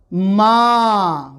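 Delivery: मा with a long drawn ā; long ā demo. Shown as a demonstration. Teacher demonstrating the long vowel to students, drawn-out मा. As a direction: stretch the vowel in मा. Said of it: "word बना".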